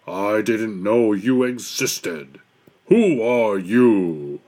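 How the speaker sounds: slow, booming bear voice; shouting Bear. A narrator's reading. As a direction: drag it out like a big blustering Bear. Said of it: "shouted Bear".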